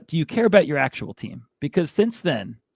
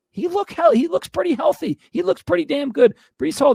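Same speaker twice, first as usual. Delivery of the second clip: much higher and louder, fired up; excited praise; 12 semitones higher, 4 dB louder than usual. Real, not acted. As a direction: gush about the player. Excited praise of Breece Hall.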